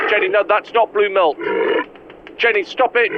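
high urgent call; alarmed calling out. Acted. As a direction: shout her down, alarmed. Host yelling at Jenny.

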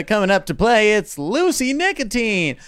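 high, slow stadium announcer call; baseball announcer. Acted. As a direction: call the batter up to the plate. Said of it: "I sound like a baseball announcer".

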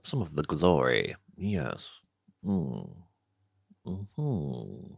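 mock regal murmur. Mock grand low-voiced pronouncement.

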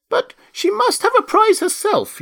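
very high, loud squeaky mouse. A narrator's reading. Mouse speaking up for Alice, squeaky protest. Mouse objecting, voiced in story.

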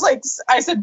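normal talking voice

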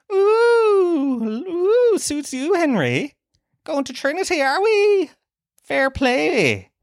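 very high falsetto teasing, stretched oohs; hoity-toity mockery. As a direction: tease with a squealing, hammed-up la-di-da. Teasing Henry about going to Trinity.

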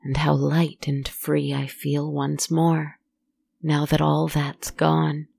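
haunting first-person storytelling; eerie narration. A narrator's reading. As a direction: tell it like a ghost story by the fire. First-person horror monologue over music.

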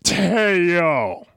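drawn-out joke impression; Terry Boy. Host explaining the wrestler to co-host, drawn-out mock impression. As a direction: stretch the name like a catchphrase. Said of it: "Terry Boy from Michinoku Pro".

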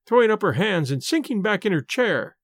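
usual speaking voice

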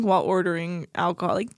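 ordinary delivery

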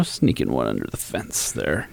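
conspiratorial hush. Acted. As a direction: murmur it like sneaking past guards. Hushed conspiratorial repeat.

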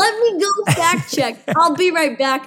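a light jab as the hairdresser; playing the hairdresser, a touch hammed-up; mock hairdresser.

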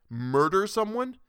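high, slow, scornful question; sarcastic jab. Acted. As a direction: drag it out, mocking the very idea. Arthur mocks her idea.

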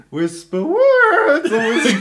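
"Whisper words" jumps high into a loud breaking falsetto, slow; voice cracking. Retold or imitated. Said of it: "seine Stimmbruchstimme rausgeholt".

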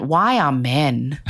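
low, slow, drawn-out retort; deadpan question. Retold or imitated. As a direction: ask it low and drag out each word. Teller's own deadpan reply, retold.

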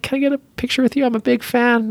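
put-on high voice of a nervous young fan; eager fan. Fan asks for picture, retold.

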